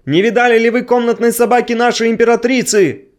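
high calling shout; shouting from afar. Acted. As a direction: call it out from a distance. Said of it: "издали кричали".

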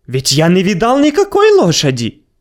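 high, sly denial with a smile; smiling. Acted. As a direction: deny it with a smirk. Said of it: "улыбаясь".

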